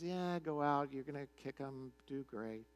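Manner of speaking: soft, unimpressed sarcasm, sinking away; mildly sarcastic. Retold or imitated. Micaiah answers the king's battle question, mildly sarcastic. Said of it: "kinda sarcastically just says".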